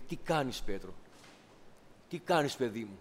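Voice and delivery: πιο σιγανά, με αγάπη και πόνο; μίλα σαν πατέρας σε παιδί που λάθεψε; τρυφερή επίπληξη. Retold or imitated. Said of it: "τον κοιτάζει με αυτή την αγάπη". (ο Κύριος μαλώνει τρυφερά τον Πέτρο, πιο σιγανά)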